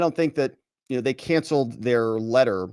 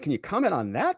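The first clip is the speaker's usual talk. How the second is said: usual speaking voice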